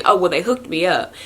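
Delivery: normal talking voice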